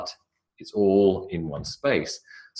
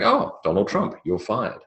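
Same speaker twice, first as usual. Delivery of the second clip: Trump catchphrase; 3 semitones higher than usual. Retold or imitated.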